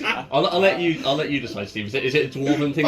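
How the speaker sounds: usual speaking voice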